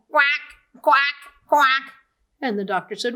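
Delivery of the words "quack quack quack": loud playful quack quack; puppy quacking instead of barking; duck quacks. A narrator's reading.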